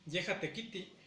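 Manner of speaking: "Yeja tequiti" with the final I cut off short; Nahuatl pronunciation demo. Shown as a demonstration. Teacher demonstrating "Yeja tequiti" with a short final I. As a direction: clip the last "i" of "tequiti" quickly. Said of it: "Fíjense".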